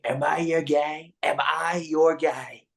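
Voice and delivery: slow, lightly put-on Trump asking; drawn-out needy question. Trump fishing for a supporter's approval, slow put-on voice. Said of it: "But he's like".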